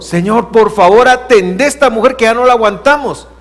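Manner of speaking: high, loud, fed-up plea; exasperated begging. Retold or imitated. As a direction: beg him to deal with her, at the end of your patience. Disciples begging Jesus.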